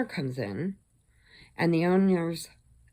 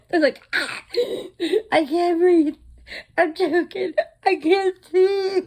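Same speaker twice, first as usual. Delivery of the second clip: laughing mock choking; 13 semitones higher, 8 dB louder than usual. Mocking the choking workers.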